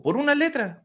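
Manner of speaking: high, incredulous question; acted parent's high-pitched protest; indignant disbelief. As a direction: query it in outraged disbelief. Indignant parent in read joke.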